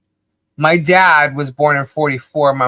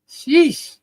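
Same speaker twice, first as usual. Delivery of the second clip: high sheesh; 12 semitones higher, 4 dB louder than usual. Real, not acted. Exaggerated exclamation.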